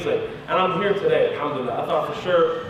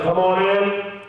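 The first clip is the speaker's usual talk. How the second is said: curt official at the interview; no-nonsense officer voice; stern interrogator. Retold or imitated. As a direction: summon them like an interrogator. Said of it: "the tone became immediately different".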